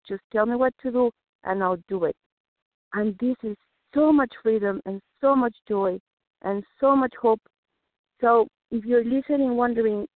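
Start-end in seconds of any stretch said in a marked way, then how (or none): none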